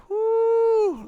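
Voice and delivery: sharp high whoo of rising temper; lightly voiced exasperated whoo; mock angry whoo. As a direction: let the frustration burst out in a high whoo. Child's wrath bursts out, angry exhale.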